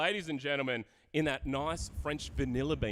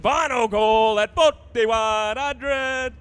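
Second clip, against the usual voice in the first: auctioneer calling; 7 semitones higher, 11 dB louder, much slower than usual. Acted. Auctioneer closing the lot.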